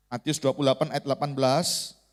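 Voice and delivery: normal talking voice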